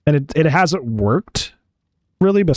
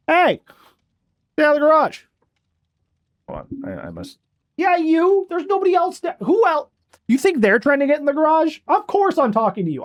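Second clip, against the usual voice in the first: high, pet-scolding voice; scolding the cat; 12 semitones higher than usual. Real, not acted. Owner scolds his cat away from the garage, high and sharp. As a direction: tell the cat off, pitched way up.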